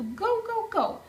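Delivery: high, bouncing impatience; an eager little "go, go"; eager, impatient. A narrator's reading. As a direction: chant it like an impatient kid.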